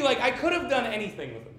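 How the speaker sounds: usual speaking voice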